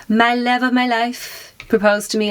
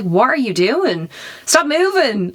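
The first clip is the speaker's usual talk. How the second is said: rising higher into a panicky cry; panicked plea; 7 semitones higher, slower than usual. Retold or imitated. On the Ferris wheel, telling her fiancé to stop moving, panicky and higher. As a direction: snap at him to stop, frightened. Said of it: "And I was like".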